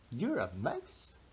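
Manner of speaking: ordinary delivery, nothing marked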